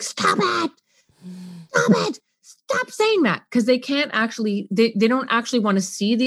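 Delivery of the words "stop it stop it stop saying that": put-on high, exasperated kid; annoyed child. Child tired of emotion being mirrored, high exasperated. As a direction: push back like an irritated kid. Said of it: "they will often be like".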